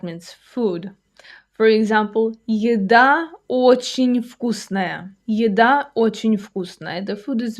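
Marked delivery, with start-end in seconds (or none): 2.5-5.2 slow, measured teaching delivery; slow model sentence. Shown as a demonstration. Teacher giving a food example to learners, slowly. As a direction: draw out each word for learners.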